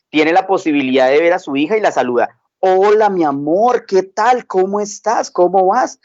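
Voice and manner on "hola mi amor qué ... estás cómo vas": lightly voiced caring dad, a bit higher and slower; tender father. Father gently greets his stressed five-year-old, slower and a touch higher.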